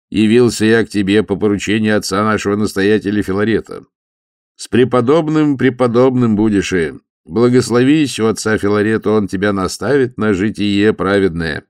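громкий торжественный церковный возглас; возгласи, как на церковной службе; возглашая, как на службе. A narrator's reading. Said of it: "возгласил хорошо поставленным голосом". (монах торжественно объявляет волю настоятеля, громко)

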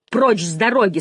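shouted order. A narrator's reading. Janine orders the lieutenant out of her way, shouting. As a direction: shout it as a sharp demand. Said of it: "снова крикнула Жанин".

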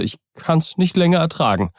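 put-on an old servant whose nerves are fraying; frightened old servant. Poole confesses his dread.

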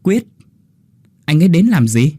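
rising, puzzled ask; surprised question. Acted. Linh asks her mother, surprised. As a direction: caught off guard, asking why. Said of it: "Linh ngạc nhiên mà hỏi".

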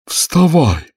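high, drawn-up order; storybook creature giving a command. A narrator's reading. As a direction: order it like a plaintive turtle. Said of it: "приказал Грифону Квази".

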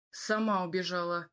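low-key sullen reply; grumbled. A narrator's reading. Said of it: "буркнул я".

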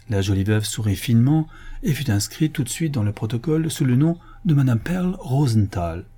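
usual speaking voice

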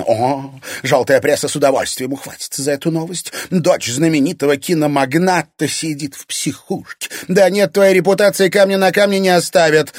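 mocking, rising to high and loud; unafraid mockery. A narrator's reading. Irina threatening father with scandal, unafraid mockery. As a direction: relish the threat, building as you go. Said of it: "Ничуть не испугалась Ирина".

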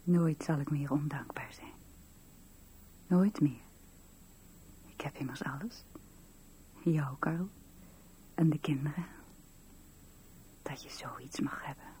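soft, breathless whisper of gratitude; whispered vow. Acted. Wife whispers thankfully to her husband, hushed. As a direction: murmur it like a prayer.

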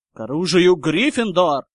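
loud rallying shout; battle cry. Acted. Gryffindors joining the standoff, shouting. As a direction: bellow a call to arms.

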